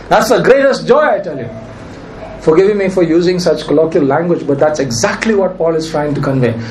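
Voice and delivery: normal talking voice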